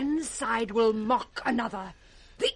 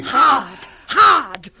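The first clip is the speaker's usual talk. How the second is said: loud, clipped shout; fierce repeated shout; shouted urging; 9 dB louder than usual. Acted. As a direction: yell at them to tie tighter.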